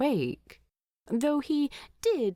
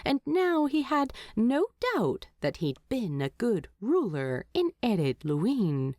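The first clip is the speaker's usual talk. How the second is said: ordinary delivery, nothing marked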